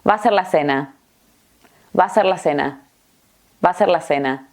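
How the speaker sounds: VA A SER merged as "vaser"; quick dictation phrase, words run together; très rapide. Shown as a demonstration. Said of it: "Lo voy a decir muy rápido".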